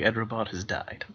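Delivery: normal talking voice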